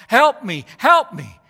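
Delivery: put-on an old man crying out for help, loud and high; calling for help.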